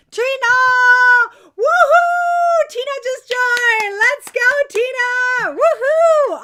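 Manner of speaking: high, loud celebratory shouts; excited cheering. Real, not acted. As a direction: whoop for a new member like at a party. Celebrating Tina joining.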